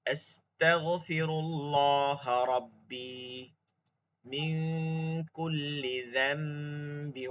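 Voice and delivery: slow, careful recitation of the dua. Shown as a demonstration. Teacher reciting the repentance dua to the class. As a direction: recite it slowly and piously.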